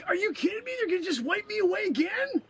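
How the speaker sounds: high, incredulous complaint; outraged disbelief. Acted. Man being wiped from history, incredulous. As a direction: pitch it high in comic outrage.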